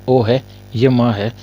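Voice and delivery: normal talking voice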